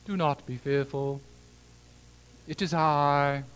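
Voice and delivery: put-on stately movie Jesus in a British accent; measured, mock-solemn British English. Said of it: "Jesus with a British accent".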